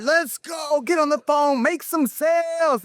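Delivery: high, shouted pep-rally call to the sales floor; hype shout. Retold or imitated. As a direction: fire up the callers like a coach, yelling.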